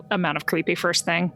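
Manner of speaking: normal talking voice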